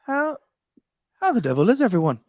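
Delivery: put-on quick British accent, pitched well up; British accent impression. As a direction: mimic a British tech YouTuber's greeting. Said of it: "I may be able to nail his accent".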